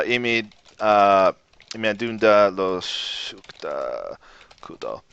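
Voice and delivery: Lojban sentence spoken slowly; careful Lojban. Shown as a demonstration. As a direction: say imi adunda lo shukta kudo slowly. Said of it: "when I say something like this".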